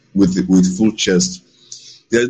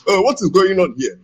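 lightly put-on higher voice; imitated Nigerian speaker; 9 semitones higher than usual. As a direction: mimic how he supposedly sounds, pitched up. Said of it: "this is how he sounds".

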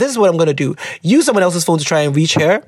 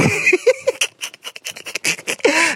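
high laugh; 10 semitones higher, 4 dB quieter than usual. Real, not acted. Laughs at letter opening.

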